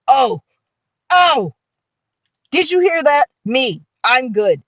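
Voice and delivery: loud, rising thrilled reaction; acted delight. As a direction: show off the praise you just got. Acted delight at being praised.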